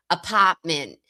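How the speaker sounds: "Apartment" with a hard, open "ah"; LTL's hard "ah". Retold or imitated. Imitating LTL when fired up, hard "ah" in "Apartment". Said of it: "a really hard, ah, like, he'll say".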